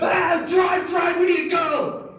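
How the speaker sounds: very high, full-force scream; panicked screaming. Acted. Thief screams at friends as dogs growl, panicked screaming. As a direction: scream at the getaway driver to go. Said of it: "start screaming".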